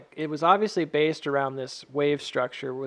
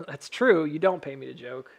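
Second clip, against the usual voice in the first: ordinary talk, nothing marked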